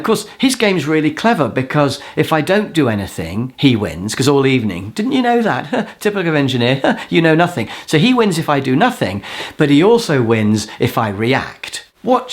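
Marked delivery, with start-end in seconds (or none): 5.0-7.7 high taunt broken by scoffing laughs; mocking needling. Games player goading an engineer all evening, high and mocking. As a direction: imitate a smug sneer.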